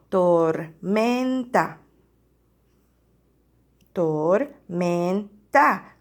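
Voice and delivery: "Tormenta" very slowly, split tor, men, ta; syllable by syllable. Shown as a demonstration. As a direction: count out the syllables of "Tormenta" with gaps. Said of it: "¿Cuántas sílabas?".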